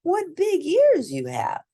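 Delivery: put-on high, loud girl's voice; wide-eyed girl marvelling; girl eyeing the disguised villain; little girl voice. A narrator's reading.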